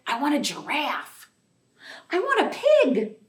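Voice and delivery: put-on demanding little girl. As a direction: nag like a little girl who wants a pet. Child's demands.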